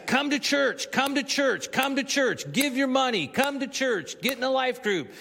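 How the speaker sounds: high-pitched, sing-song list of demands; repetitive church urging. Retold or imitated. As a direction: parrot each appeal in the same high tune. Mimicking repeated church messaging.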